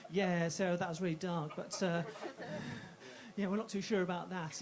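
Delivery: lightly voiced low, doubtful club comic; disapproving colleague. Comedy club to Sarah about dark jokes, mild disapproval.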